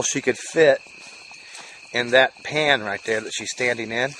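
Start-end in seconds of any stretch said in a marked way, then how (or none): none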